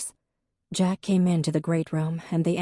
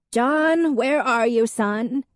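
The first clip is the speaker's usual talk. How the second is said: high, loud call to her son; grandmother calling; 7 semitones higher, 5 dB louder, slower than usual. A narrator's reading. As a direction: summon him from the kitchen.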